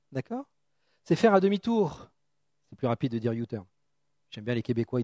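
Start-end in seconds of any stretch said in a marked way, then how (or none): none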